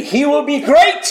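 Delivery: high, ringing angelic announcement; exultant preacher voicing the angel; triumphant proclamation.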